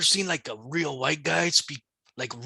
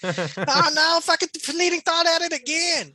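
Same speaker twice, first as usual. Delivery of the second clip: mock offended listener; 12 semitones higher than usual. Offended foreign listener to the hosts, in a high, shrill-free mock outrage. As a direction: ham up a listener who is outraged. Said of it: "he's so pissed off".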